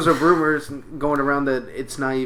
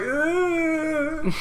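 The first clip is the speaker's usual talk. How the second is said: high mock moan. Voicing woman moaning.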